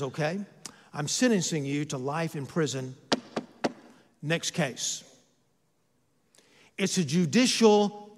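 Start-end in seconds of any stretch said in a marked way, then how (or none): 0.0-5.0 matter-of-fact, low judge's ruling; unemotional, businesslike judge; judge passing sentence. Acted. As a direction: hand down a sentence softly, without emotion. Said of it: "But the judge, on the other hand".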